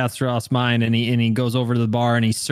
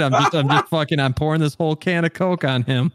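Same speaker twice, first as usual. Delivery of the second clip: ordinary talk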